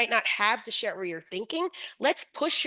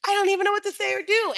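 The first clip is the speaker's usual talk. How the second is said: higher, fast, flustered mock panic; panicked teacher; 10 semitones higher, 5 dB louder, faster than usual. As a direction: rush it out like a teacher at a loss. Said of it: "they're like".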